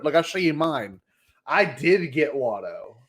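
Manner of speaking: ordinary talk, nothing marked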